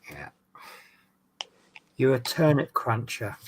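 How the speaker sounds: usual speaking voice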